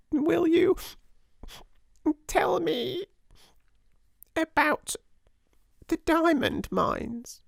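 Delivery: very high small whimper; whimpering child. A narrator's reading. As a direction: ask hopefully through sniffles. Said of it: "whimpered Lottie".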